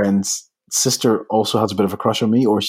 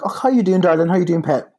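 sweet mum voice; 8 semitones higher than usual. Retold or imitated. Mum's sweet greeting voiced.

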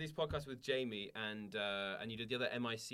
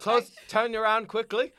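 playful shout; 9 semitones higher, 14 dB louder, slower than usual. Pretend. Acting out a dating scene.

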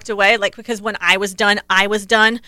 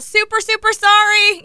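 yelled apology; 11 semitones higher than usual. Retold or imitated. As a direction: shout sorry over to the Germans. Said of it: "I yell out".

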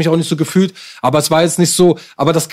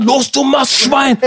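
drawn-out, high-pitched bellow; imitated gym yell; 9 semitones higher, much slower than usual. Bouncer standing over a skinny lifter, imitated drill shout. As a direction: bellow it slowly like a monster gym bruiser. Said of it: "schreit mich an".

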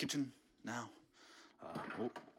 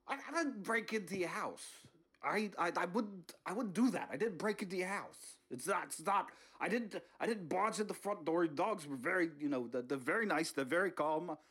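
Bernie voice; 2 semitones higher than usual. Acted. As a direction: play Bernie caught red-handed, all innocence.

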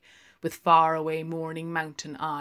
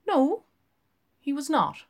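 put-on quick, much higher young barmaid; 10 semitones higher, 6 dB louder than usual. Barmaid answering Lenehan, high and quick. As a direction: pert barmaid, rattling it off.

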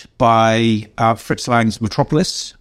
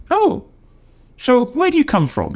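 high, light imitation of an interested Ron; quoting Ron; 14 semitones higher than usual. Ron asking the writer where he comes from, voiced high. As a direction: lift the voice into Ron's eager question.

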